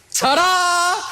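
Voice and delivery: high, drawn-out ta-da; mock showman flourish. Commentator mocking the referee's flourish, showy and high. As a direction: sing out a mock grand reveal.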